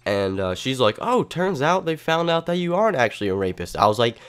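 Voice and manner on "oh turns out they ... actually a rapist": higher-pitched, casual imitation of a nurse; a hint of a chirpy nurse; lightly voiced nurse. Nurse telling the patient he is cleared, lightly voiced higher.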